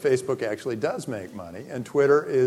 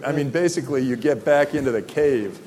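ordinary talk, nothing marked

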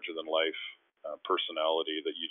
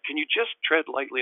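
high, urgent plea; pleading; 8 semitones higher, 4 dB louder than usual. Retold or imitated. Player begging agents to be careful, pleading. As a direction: beg for caution, pitched up.

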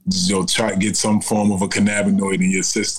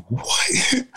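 high, rising incredulous question; amused disbelief, laugh right behind it; retold astonishment. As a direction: imitate your stunned reaction, pitch leaping up. Retold stunned reaction to miracle.

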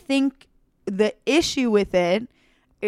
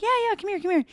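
sweet welcome; 10 semitones higher, much faster than usual. Retold or imitated. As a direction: coax her over gently in a lifted voice. Inviting friend to lie beside her.

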